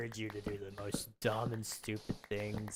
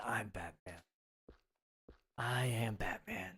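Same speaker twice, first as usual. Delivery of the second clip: Batman voice; slower than usual. Pretend. Playing at being Batman.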